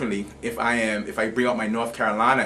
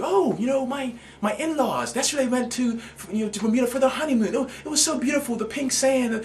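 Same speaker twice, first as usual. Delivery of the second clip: high, breezy mock-gushing voice, much higher; gushing stranger; 13 semitones higher than usual. Teacher imitating strangers raving about Bermuda, high mock gush. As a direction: play the starry-eyed tourist cooing about a honeymoon. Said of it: "The conversations shift to".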